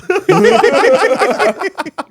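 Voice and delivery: long burst of high-pitched laughter; real uncontrolled cackle, loud and high. Real, not acted. Host cracks up over keeping gross story anonymous, loud cackle.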